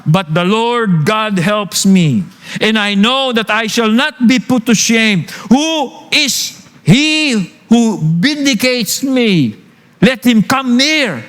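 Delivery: slow, rising declamation; scripture reading. Acted. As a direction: proclaim the verse boldly. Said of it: "Sabi po sa talata". (pagbasa ng talata nang may diin)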